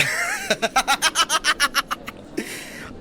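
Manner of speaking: long, high burst of laughter; high cackling laugh; gleeful laughter. Acted. Emperor laughs at his joke.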